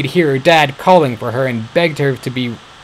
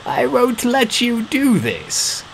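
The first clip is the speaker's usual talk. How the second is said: put-on old father voice; 13 semitones higher, much slower than usual. A narrator's reading. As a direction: plead weakly.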